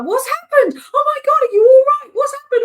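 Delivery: high, loud alarmed husband; worried husband shouting in alarm; panicked husband. Retold or imitated. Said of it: "my husband was like".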